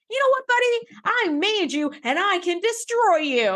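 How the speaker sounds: high-pitched imitation of an indignant superior; mock threatening. Pressman turning on the officer he made, mock threatening.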